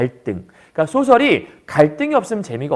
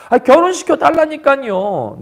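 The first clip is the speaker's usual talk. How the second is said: whiny insisting; 7 semitones higher, 4 dB louder than usual. Acted. Boy insists again.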